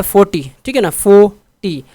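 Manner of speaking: Forty loud on four, short टी; stress on first syllable. Shown as a demonstration. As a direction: hit the front of forty. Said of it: "तो first पे ज़्यादा है".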